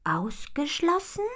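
put-on high, slow, timid little girl's question; cautious question. A narrator's reading. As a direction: ask carefully, not looking up. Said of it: "fragt Ponyfee vorsichtig". (vorsichtig, hoch und zaghaft: „Ausgeschlossen?“)

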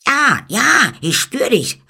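high, loud, startled yelps; pained cry. A narrator's reading. Eamon feels the thorn prick his ear, crying out high and loud. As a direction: cry out as if just jabbed. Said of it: "rief er dann".

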